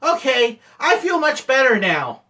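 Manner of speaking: high, loud, cheerful relief; play-acted happy relief; mock relief. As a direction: announce it as if all is well now. Feigned relief after the prank.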